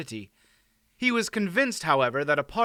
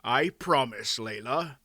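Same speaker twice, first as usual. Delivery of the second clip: put-on solemn old man's promise; mildly grave, measured reply. A narrator's reading. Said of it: "Mister Wolf said gravely".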